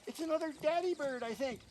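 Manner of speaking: high little chick voice; baby bird voice. A narrator's reading. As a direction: chirp it like a nestling. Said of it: "nestlings chattering".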